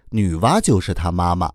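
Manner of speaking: ordinary delivery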